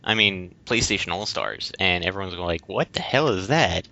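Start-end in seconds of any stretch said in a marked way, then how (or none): none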